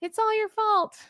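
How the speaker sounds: high, sharp mock accusation; blaming wife. Imagined wife blaming her husband, high mock scolding. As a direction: throw the blame at him in a put-on voice. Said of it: "I can just hear it like".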